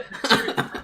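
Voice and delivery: breathy burst of laughter; high unvoiced laugh. Real, not acted. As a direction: let out an airy laugh. Laughs at being teased.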